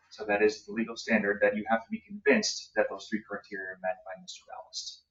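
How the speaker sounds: ordinary talk, nothing marked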